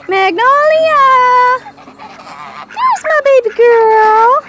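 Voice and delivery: very high, loud cooing call. Real, not acted. Owner calling her goose Magnolia, very high baby talk. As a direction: coo at her pet goose.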